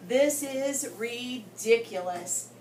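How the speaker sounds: ordinary delivery, nothing marked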